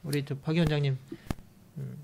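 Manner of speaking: normal talking voice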